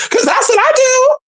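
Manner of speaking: squeaky high voice; high squeal. Real, not acted.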